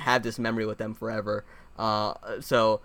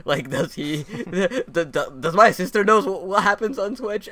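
high, laughing mock panic, stumbling over words; laughing disbelief; 7 semitones higher, 4 dB louder than usual. Uncle retelling his alarm at nephew on Twitch, laughing. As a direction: laugh through a half-joking alarmed question.

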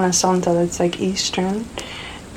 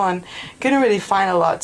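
ordinary delivery

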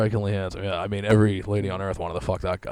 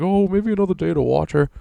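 mocking nurse; 9 semitones higher, 6 dB louder than usual. Mocking hospital staff.

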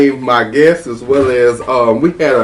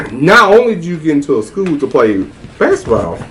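usual speaking voice

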